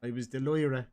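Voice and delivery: put-on Dublin storytelling; Dublin accent. Shown as a demonstration. Host demonstrating slang for co-host, Dublin accent. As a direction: lay on the Dublin vowels. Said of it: "It's proper Dublin".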